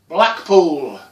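loud, triumphant announcement; loud exclaimed reveal; big reveal. A narrator's reading. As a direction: proclaim the destination with a flourish. Announcing the surprise destination.

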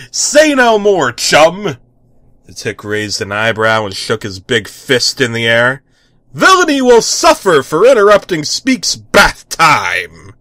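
loud, grand superhero proclamation; heroic vow. Acted. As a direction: swear vengeance boldly. Hero vows justice.

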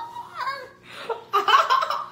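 high, loud giddy laugh; squealing laugh. Real, not acted. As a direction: burst into a delighted high laugh. Laughs at the reveal.

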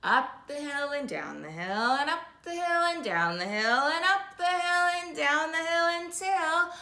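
usual speaking voice

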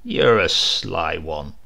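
a knowing sardonic jab, said slowly; sardonic mockery. A narrator's reading. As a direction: drawl it with sly amusement. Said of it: "mockingly said, with a sardonic tone".

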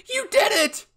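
very high, full-out shriek of joy; excited yell. A narrator's reading. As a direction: scream it with delight. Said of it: "Hanjay yelled".

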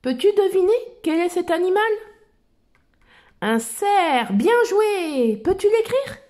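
put-on bright, high child explorer voice; Dora voice. Reading Dora's lines to little listeners, in a high cheerful voice. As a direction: lift the voice like Dora talking to kids.

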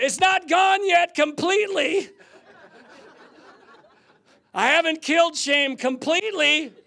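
high, loud outcry; exclaiming. Retold or imitated. Man admits shame still lingers, crying out loudly. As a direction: cry it out at high pitch.

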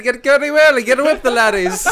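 loud, high, hammed-up Irish accent; over-the-top Irish yell, breaking into laughter. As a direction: shout it in a comic Irish brogue. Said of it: "like, in an Irish accent".

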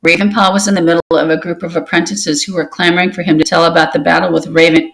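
ordinary delivery, nothing marked

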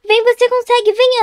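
torcida alta e aguda; incentivo animado. Real, not acted. Incentiva Ana a passar. (incentivando com empolgação)

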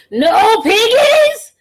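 very slow, high, loud cry; furious wail. Acted. Gritch howling at the farmer, slow and loud. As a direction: howl it in a rage.

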